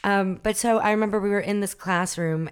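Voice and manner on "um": usual speaking voice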